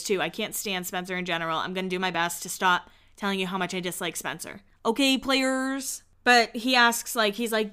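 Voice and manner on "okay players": slow, higher mock player voice; Spencer's new voice. Host mimics the Spencer she dislikes, slow mock player voice. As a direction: stretch it out as a mocking player. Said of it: "I hate his new voice".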